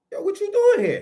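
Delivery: high, startled greeting; shocked to find him there; yo, way up in pitch; surprised. Retold or imitated. Surprised to see brother in jail.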